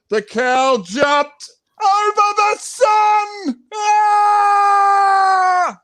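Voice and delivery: dramatic shout. Acted. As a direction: build to a dramatic cry. Dramatic climax with scream.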